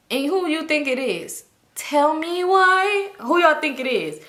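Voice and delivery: milk the suspense, dragging it out; drawn-out tease. Real, not acted. Teasing her viewers before the reveal, slow and drawn out.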